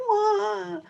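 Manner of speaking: high wah with a laugh in it; echoed mock wail. A narrator's reading. The echo across the pond, high mock wail. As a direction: wail back like a playful echo. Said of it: "said the voice from across the pond".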